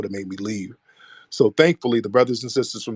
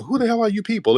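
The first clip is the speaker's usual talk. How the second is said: baffled outburst; 10 semitones higher, faster than usual. Retold or imitated. Teller baffled at pointing hecklers.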